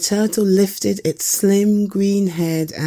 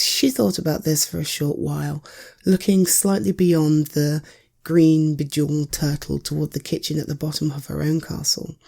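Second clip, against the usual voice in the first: plain reading voice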